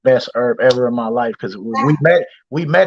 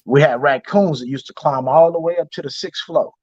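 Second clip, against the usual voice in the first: normal talking voice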